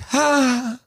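tiny nervous high "Ha"; audience's uneasy laugh; scared laugh. Retold or imitated. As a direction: mimic a scared single laugh. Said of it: "they were kinda like".

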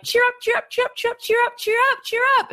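very high sing-song cheer up; mock chirpy parent. Parent jollying a crying baby, in a high chirpy sing-song. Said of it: "So there's either, like".

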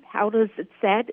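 ordinary talk

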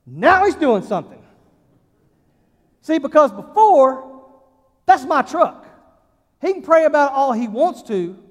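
loud, driving pulpit preaching; full-force preaching. Acted. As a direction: preach it hard to the congregation.

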